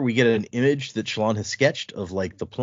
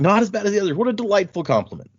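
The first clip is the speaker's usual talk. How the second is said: higher, mock-delighted sarcasm; sarcastic thanks; 9 semitones higher, faster than usual. Noble mocking her guard's backhanded praise, higher and sarcastic. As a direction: drip sarcasm over the compliment. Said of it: "she's like".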